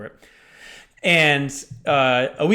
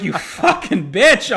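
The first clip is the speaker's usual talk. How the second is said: raised, drawn-out retold cursing; mock outrage; 5 semitones higher, 4 dB louder, much slower than usual. Father reacting to daughter's lie, mock outraged. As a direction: play up slow, stunned indignation.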